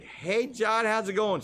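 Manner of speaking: high hailing greeting; calling over the water, friendly; mock greeting call. Charter boat crew hailing the fisherman. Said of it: "they're like".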